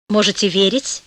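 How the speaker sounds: usual speaking voice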